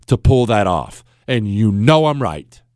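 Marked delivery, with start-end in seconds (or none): none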